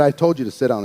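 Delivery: low, serious teacher voice; a stern order, kept low; dead serious. Retold or imitated. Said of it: "dead serious".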